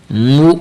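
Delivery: مو cut off with a sharp glottal jolt; hamza jolt. Shown as a demonstration. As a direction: give مو a quick catch in the throat. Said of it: "میم کو حمزہ کے ساتھ جھٹکا دینا".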